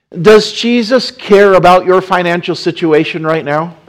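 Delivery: loud, high rising preacher's question; preaching. Acted. Preacher asking the congregation, loud and high.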